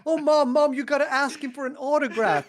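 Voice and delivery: high, loud pleading with a laugh; excited begging. Retold or imitated. Sister to Mom, loud high pleading for an autograph. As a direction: beg Mom urgently, giggling.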